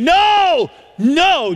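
NO cried high and loud, then repeated; each NO leaps up and rings out; emphatic refusal. Retold or imitated. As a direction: protest with a high, forceful NO. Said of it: "he says it in such an emphatic way".

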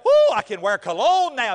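loud, hammed-up thrilled whoop; excited teenager. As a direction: crow it like a thrilled thirteen-year-old. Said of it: "he's all excited".

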